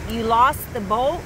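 normal talking voice

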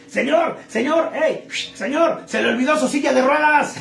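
a waiter chasing a customer down the street; waiter calling out. Acted. Waiter runs after the drunk. (mesero que le grita al borracho que se va)

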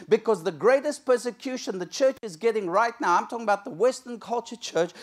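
roaring sermon climax; full-force preaching. Acted. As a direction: thunder it from the pulpit.

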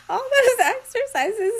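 fighting off the giggles; giggly high retry; giggling. Real, not acted. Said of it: "getting all giggly".

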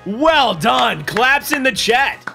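ordinary talk, nothing marked

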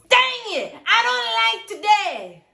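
high, loud, slow sulky yell; whiny yell. Acted. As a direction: yell it like a sulking kid. Catchphrase yelled.

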